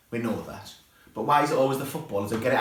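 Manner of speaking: normal talking voice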